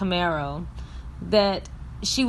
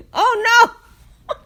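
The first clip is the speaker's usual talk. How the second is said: high, louder panicked oh no; alarmed cry; 14 semitones higher, 7 dB louder than usual. Retold or imitated. Bernice seeing the Camaro charge at her, high alarmed yelp. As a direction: yelp it as the car comes at her. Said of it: "you hear Bernice say".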